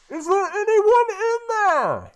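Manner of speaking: shrill-high, loud call through a door; high shouting stranger. Acted. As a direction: call out loud and high, unsure who's there. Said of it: "one person says".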